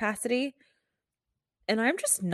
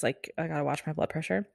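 normal talking voice

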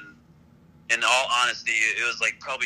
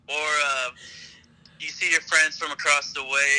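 ordinary delivery

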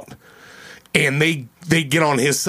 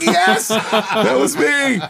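laughing; 5 semitones higher, much slower than usual. Retold or imitated. Admitting it, laughing.

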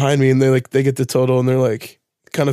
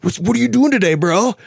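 high, super excited barista bro; hammed-up, pitched-up bro enthusiasm; Dutch Bros voice; 8 semitones higher than usual. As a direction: greet the customer like an overexcited surfer dude. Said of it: "this is my Dutch Bros voice".

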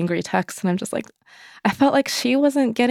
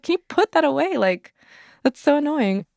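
higher, fed-up request, half laughing; annoyed plea; 9 semitones higher than usual. Retold or imitated. New mother in labour tells partner to drop his phone, fed up. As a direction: whine at him to put the phone down. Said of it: "I was like".

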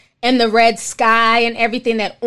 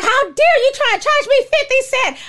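high, indignant mock outrage; going off; 11 semitones higher than usual. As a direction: play up the outrage, pitch way up. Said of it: "I went off".

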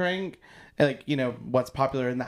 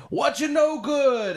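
high, loud, drawn-out holler; mock Southern scream; 12 semitones higher, 5 dB louder, slower than usual. Imitating Southern people greeting each other, screamed high and slow. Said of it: "they literally come up and scream that".

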